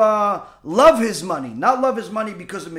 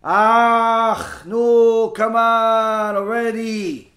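slow, drawn-out mock exasperation; fed-up groaning; much slower than usual. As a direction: drag it out like a husband who's had enough. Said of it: "and now he's had it".

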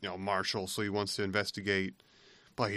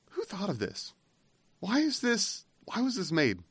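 mock incredulous, much higher; high-pitched who-made-this bafflement; baffled disbelief; 10 semitones higher than usual. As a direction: ask it in amused disbelief. Retelling his own baffled reaction.

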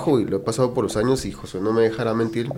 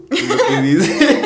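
slow, laughing tease; 13 semitones higher, 6 dB louder, much slower than usual. Real, not acted. Teasing the doctor about his accent, laughing. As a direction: drag it out through laughter.

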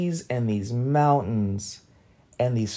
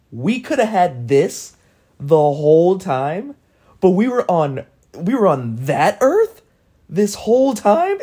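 louder, higher, climbing to an incredulous question; outraged disbelief; 5 semitones higher, 5 dB louder than usual. Retold or imitated. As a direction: build indignation toward a high, loud finish. Outraged disbelief at wasted life.

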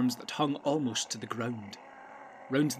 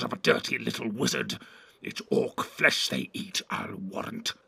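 sneering, evil orc; sinister contempt, whispery at first, rising to anger; Grishnak. A narrator's reading. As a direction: sneer and taunt a rival orc chief. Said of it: "sneered the evil voice".

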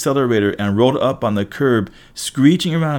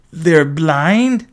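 high, louder startled question; incredulous question; 7 semitones higher, 5 dB louder than usual. A narrator's reading. Passenger learning the drivers are blind, incredulous. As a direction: blurt it in disbelief.

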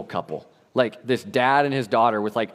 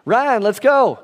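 put-on higher, louder kid calls; mocking cousins; 9 semitones higher, 4 dB louder than usual. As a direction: imitate bossy cousins calling out. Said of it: "That's how they talk".

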